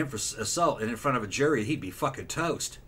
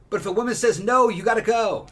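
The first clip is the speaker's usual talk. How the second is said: raised, louder insistence; emphatic; 8 semitones higher, 8 dB louder than usual. Real, not acted. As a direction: drive the point home, louder and higher. Emphatic point on rejection.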